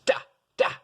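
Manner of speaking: "da, da" as two short, sharply accented hits. Shown as a demonstration. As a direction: strike each "da" hard and short. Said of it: "It is a sharp accent".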